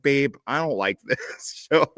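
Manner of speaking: slow, whiny complaining. Retold or imitated. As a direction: whine it like a sulky boyfriend. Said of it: "So I did my whiny voice".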